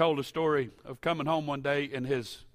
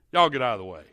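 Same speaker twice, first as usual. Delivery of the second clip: lightly voiced old man, abrupt and loud; Brother Alexander; 8 dB louder, faster than usual. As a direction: loudly order the boys aside, light imitation. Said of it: "in his Brother Alexander way".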